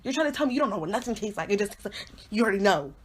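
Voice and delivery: usual speaking voice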